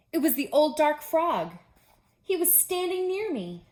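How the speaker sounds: ghost-story scare; spooky reveal. Acted. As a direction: build the fright to a peak. Scary climax of ghost story.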